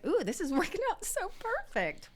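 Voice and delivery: high, thrilled ooh over the rhyme; delighted squeal. Real, not acted. Host thrilled the episode name rhymes, high squeal.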